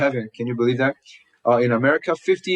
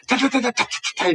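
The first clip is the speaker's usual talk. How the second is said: rapid ta ta taps jumping much higher; tapping clicks, pitched way up; imitating metallic tapping; 11 semitones higher, much faster than usual. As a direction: mimic a rattling metal noise. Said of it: "It's making metallic noises".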